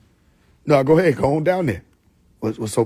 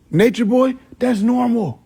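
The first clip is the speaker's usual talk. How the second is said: hammed-up Nature Boy; 9 semitones higher than usual. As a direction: announce it like a wrestler. Higher, louder flourish.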